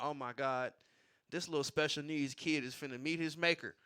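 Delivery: low, soft aside full of dread; hushed horror. Retold or imitated. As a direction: mutter it like you can't bear to watch. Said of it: "I'm like".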